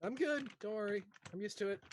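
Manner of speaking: high, put-on assurance; high-pitched reassurance. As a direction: call out that all is fine mid-climb. Acted reassurance while climbing precariously.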